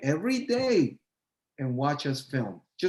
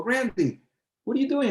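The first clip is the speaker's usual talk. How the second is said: excited greeting; 9 semitones higher than usual. Retold or imitated. Surprised run-in with old friend.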